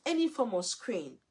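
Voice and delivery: usual speaking voice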